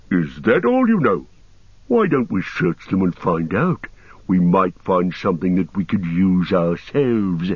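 orc egging the others on; lightly voiced plotting orc; scheming orc. A narrator's reading. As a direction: challenge them as an orc.

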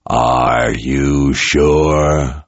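very slow, low, gravelly doubt; deep gravelly voice. Acted. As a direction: let the question crawl out deep and rough. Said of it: "came the deep, gravelly voice".

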